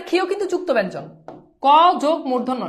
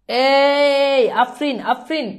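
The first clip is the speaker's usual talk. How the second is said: long drawn-out, loud "hey" to a student; calling out; 5 dB louder, much slower than usual. Real, not acted. Teacher calling a student by name, drawn-out loud call. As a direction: call to her, stretching the first word.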